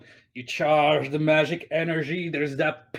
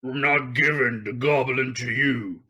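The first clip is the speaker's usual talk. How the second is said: lightly voiced rugged character; 2 semitones lower than usual. Game master voices a captor refusing to hand over, rugged voice. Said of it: "a rugged voice".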